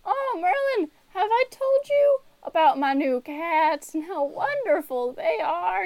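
put-on high-pitched, gushing old lady; high, chatty old-lady voice; old woman Nancy. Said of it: "and she's like".

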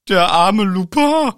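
slow, high, loud mock-pitying lament; übertrieben mitfühlend. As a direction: wail it slowly in protest. Übertriebenes Mitleid mit Lupin. (laut, gedehnt und empört bemitleidend)

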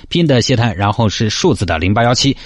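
loud, fast sign-off read; announcer read. Real, not acted. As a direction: read out the contact like an ad. Closing contact announcement.

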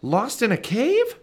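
horrified mother repeating it back; shocked. Acted. Mom learning her son was lost, shocked high cry.

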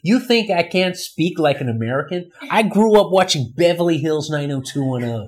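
put-on American accent. Shown as a demonstration. Australian proves to friend he can sound American, American accent. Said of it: "I wanna hear you speaking an American accent".

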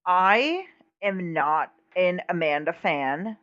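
ordinary delivery

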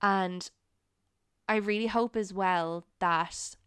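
normal talking voice

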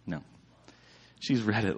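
normal talking voice

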